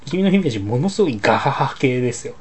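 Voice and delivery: ordinary delivery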